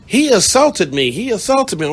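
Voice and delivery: high, loud whiny insistence; mock upset girlfriend. Girlfriend insisting to narrator she was the victim. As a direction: play the wronged victim. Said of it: "She was like".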